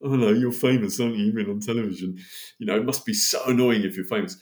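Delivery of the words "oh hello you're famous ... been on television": mock starstruck gush. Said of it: "I didn't want to do that horrible kind of like".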